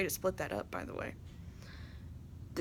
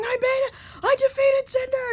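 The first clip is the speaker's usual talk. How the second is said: much higher, much louder victory yell; triumphant scream; 10 dB louder than usual. Retold or imitated. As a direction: shout it like you just beat the final boss. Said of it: "I start screaming".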